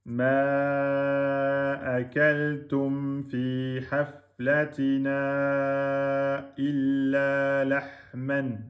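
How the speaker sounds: slow tajwid recitation stretching the long vowel in akaltum; slow Quran recitation. Shown as a demonstration. Teacher demonstrates the four-beat madd to students, recited slowly. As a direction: chant Ma akaltum fi haflatina slowly, holding each madd. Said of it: "Donc là, vous aurez droit".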